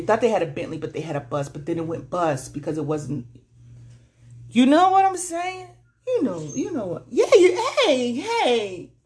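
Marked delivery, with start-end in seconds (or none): 4.5-8.9 laughing. Real, not acted. Laughing high exclamation.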